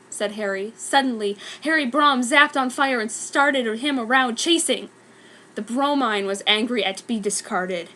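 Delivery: ordinary delivery, nothing marked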